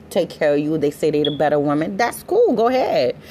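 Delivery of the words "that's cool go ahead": much higher, mock-breezy "that's cool"; daring the rivals, high and mocking; sarcastic. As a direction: wave them off with fake nonchalance.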